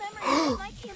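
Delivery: high, soft mock gasp; sharp intake of delighted breath; joyful gasp. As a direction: gasp with sudden hope, acted out. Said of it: "sparkle of joy in the man's eyes".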